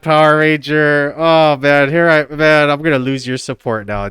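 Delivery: usual speaking voice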